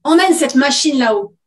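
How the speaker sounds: loud, firm command; giving an order. Acted. Mother to her daughter about the robot, giving an order. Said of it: "ordonna Bérengère à sa fille".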